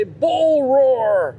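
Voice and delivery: mock drill sergeant yell. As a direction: bark it at the class like the old professor. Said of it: "He'd say".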